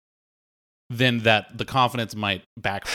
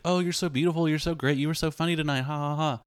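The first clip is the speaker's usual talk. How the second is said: higher-pitched gushing, lightly put on; a hint of swooning fan, pitched up; mock fawning admirers; 5 semitones higher, faster than usual. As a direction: imitate gushing party guests with a fake laugh. Mimics fawning after-party admirers.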